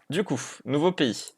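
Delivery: ordinary talk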